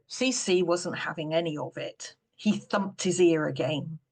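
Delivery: plain reading voice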